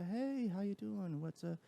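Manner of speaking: high and soft, friendly customer voice; sweet, gentle service voice. Shown as a demonstration. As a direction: lift the pitch and soften it for strangers. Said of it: "I intentionally go, like, an octave higher and softer with my voice".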